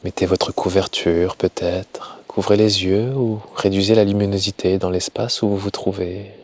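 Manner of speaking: slow, soft guiding voice with long pauses; guided meditation. Acted. Yoga teacher settling listeners for Yoga Nidra, slow calm meditation voice. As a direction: ease them gently into rest, unhurried and hushed.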